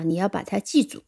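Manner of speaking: usual speaking voice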